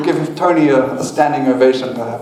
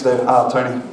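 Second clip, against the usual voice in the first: normal talking voice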